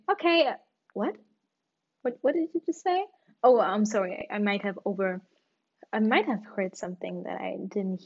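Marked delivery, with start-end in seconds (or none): 0.1-3.0 confused. Retold or imitated. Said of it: "then people say".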